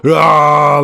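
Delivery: loud, drawn-out "aah" of agony; mock anguished yell. Streamer feigning horror over football versus soccer, mock anguished yell. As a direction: wail as if mortally wounded.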